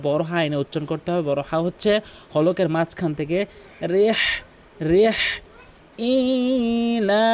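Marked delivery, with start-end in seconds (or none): 6.1-7.3 "ইলা" held long and higher; "ইলা" drawn out as in recitation; correct tajweed pronunciation. Shown as a demonstration. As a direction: stretch the alif in "ইলা" slowly. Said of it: "অধিকাংশ লোক বলে ইলাফিহিম".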